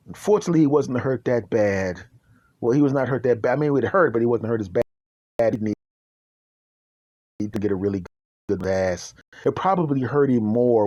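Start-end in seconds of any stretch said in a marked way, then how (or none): none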